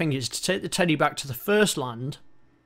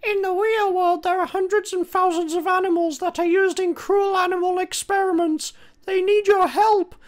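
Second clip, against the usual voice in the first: earnest teddy plea; 6 dB louder than usual. Acted. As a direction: plead like a tiny earnest teddy. Reading the game's plea aloud.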